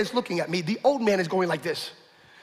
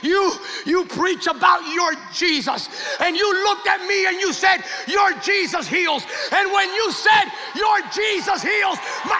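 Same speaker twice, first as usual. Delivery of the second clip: high, slow, emphatic proclamation; fervent imitated testimony; 10 semitones higher, slower than usual. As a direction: act an overwhelmed father, dragging each phrase. Father's astonished testimony, acted fervently.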